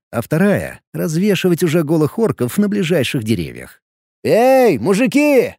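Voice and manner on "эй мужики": loud call across distance; shouting from afar. Acted. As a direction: call out loud from far away. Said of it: "издалека крикнул я".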